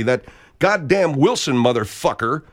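normal talking voice

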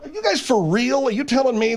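high, incredulous challenge; indignant. Acted. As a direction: demand to know if they mean it. Indignant repeat of challenge to co-hosts.

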